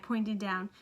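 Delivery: ordinary delivery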